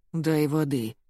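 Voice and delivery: low, weakened groan of a request; moaning. A narrator's reading. Colonel asks for water after bad news, moaning. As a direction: groan it faintly, understated. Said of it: "простонал Дегтярев".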